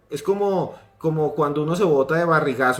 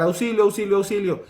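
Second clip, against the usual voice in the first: grito de auxilio imitado; 6 semitones higher than usual. Retold or imitated. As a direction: act out a boy calling for help. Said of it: "empezó a gritar y a gritar". (grito de auxilio agudo, a media fuerza)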